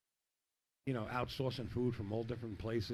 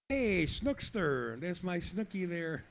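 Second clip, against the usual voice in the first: excited greeting; 6 semitones higher, 5 dB louder, slower than usual. Real, not acted. Greeting player friend on air.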